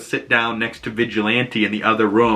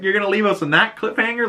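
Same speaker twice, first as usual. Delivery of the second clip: mock disbelief; 11 semitones higher, faster than usual. Reacting to cliffhanger ending.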